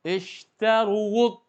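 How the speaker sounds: slow stretched "Ishtaruwud" with forced uwu; wrong reading. Shown as a demonstration. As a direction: exaggerate the incorrect long "Ishtaruwud". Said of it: "Ini tidak dibenarkan".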